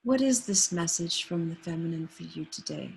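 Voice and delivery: ordinary talk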